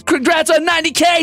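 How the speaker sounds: high, celebratory cheer; excited congratulations. Acted. Viewer congratulations read aloud.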